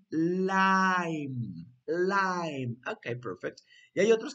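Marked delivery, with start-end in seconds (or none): 0.1-2.8 "Lime" held long and emphatic; drawn-out teacher's "Lime"; model for repeating. Shown as a demonstration. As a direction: stretch "Lime" for the class to copy. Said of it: "Repeat after me".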